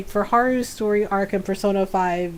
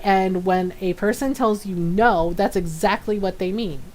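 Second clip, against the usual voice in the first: usual speaking voice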